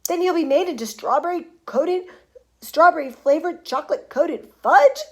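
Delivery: high, loud horrified disbelief; screaming. A narrator's reading. Mother learning her son becomes fudge, screaming. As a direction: cry it out, appalled. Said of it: "screamed Missus Gloop".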